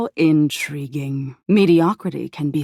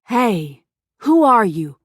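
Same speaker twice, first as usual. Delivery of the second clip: calling out; 4 semitones higher, 4 dB louder than usual. A narrator's reading. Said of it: "you call out to them".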